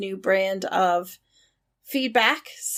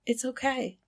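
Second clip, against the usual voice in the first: "It's okay" repeated much softer and gently falling; soft reassurance; 8 dB quieter than usual. Real, not acted. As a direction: comfort them, almost under your breath. Hosts reassuring after emotional movie.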